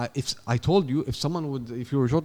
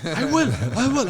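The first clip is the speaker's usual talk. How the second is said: mock angry threat; 9 semitones higher, 4 dB louder than usual. Mimicking the angry recruiter.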